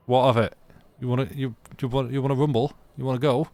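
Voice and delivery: a hammed-up "wanna rumble" dare; mock tough challenge. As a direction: square up like a pub scrapper, Yorkshire accent. Artificer squaring up to a question.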